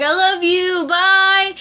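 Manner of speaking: high, loud sing-song farewell; sing-song goodbye. Retold or imitated. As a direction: sing it out sweetly. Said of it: "He's like".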